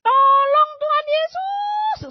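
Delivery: slow, very high and loud plea; cry with all strength. Retold or imitated. Patient calling on Jesus, loud high plea. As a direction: pour all your strength into the call. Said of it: "sekuat tenaga".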